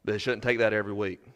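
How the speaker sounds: shouldn't said softly and fast, no push, flat fall; preacher murmuring shouldn't, soft and fast; quiet quick aside. Acted. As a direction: throw it away softly and quickly. Quiet reflective close.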